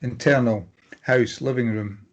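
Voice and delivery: usual speaking voice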